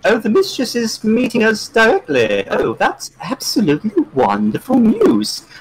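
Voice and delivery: high-pitched, thrilled put-on voice; delighted character voice. Player in character hearing of the mistress, high delighted voice. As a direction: gush in a high, airy character voice.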